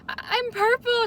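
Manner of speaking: high and loud, thrilled; squealing with excitement; excited squeal. Retold or imitated. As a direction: burst out with delight at a friend. Greeting friend in costume.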